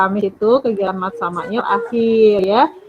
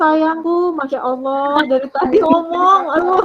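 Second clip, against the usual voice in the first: exasperated; 5 semitones higher than usual. Real, not acted. Teacher exasperated, repeated herself.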